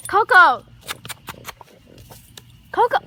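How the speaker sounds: loud call of Coco; calling the dog. Real, not acted. Girl calling her wandering dog: loud shout. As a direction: shout the name across the yard.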